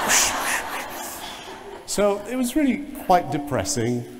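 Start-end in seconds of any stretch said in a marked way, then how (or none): 0.0-1.9 unvoiced hissed whooshes; breathy whoosh of a tail between the legs; slinking whoosh. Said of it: "That's the sound of my tail between my legs".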